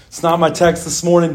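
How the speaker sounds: ordinary talk, nothing marked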